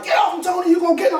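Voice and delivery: high mock yell of a grandmother; retold shout. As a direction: shout at him to let go, hammed up. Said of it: "She was hitting him, like".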